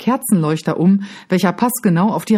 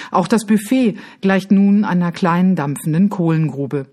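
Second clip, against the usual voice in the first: plain reading voice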